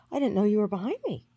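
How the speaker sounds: high, loud retold self-defence; acted-out defensive outburst; indignant protest.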